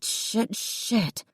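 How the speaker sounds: soft, breathy under-breath curse; muttered curse. A narrator's reading. Jessica cursing to herself, muttered. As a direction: mutter it to yourself.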